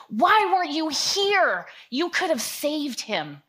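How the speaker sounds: loud, slow, anguished pleading; grieving cry. Retold or imitated. Friends grieving at Jesus, a loud anguished plea. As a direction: pound his chest and cry out why. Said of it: "I just imagine them in their grief".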